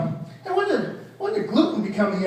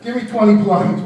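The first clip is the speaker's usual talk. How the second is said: loud workout command; personal trainer; 8 dB louder than usual. Acted. Comic resuming his trainer act, loud and commanding. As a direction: order the set like a trainer. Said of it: "I'm gonna be a personal trainer".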